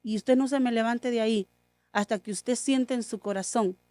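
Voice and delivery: softly, calmly reassuring; a quiet, gentle father's promise; soft and serene, no anger at all. Retold or imitated. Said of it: "con toda la serenidad".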